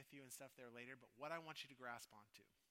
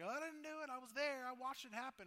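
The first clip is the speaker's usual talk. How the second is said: much higher, louder protesting innocence; mock innocent excuse; 11 semitones higher, 9 dB louder than usual. Preacher voicing someone excusing themselves, mock innocent. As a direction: protest innocence a bit too hard.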